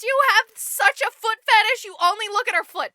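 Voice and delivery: high, loud berating; exasperated mock outrage. Real, not acted. Storyteller scolds prince for foot fetish, high and loud. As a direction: rant at the clueless prince.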